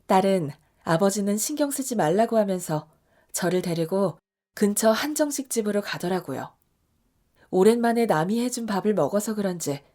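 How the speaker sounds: ordinary delivery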